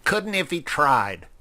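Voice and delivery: plain reading voice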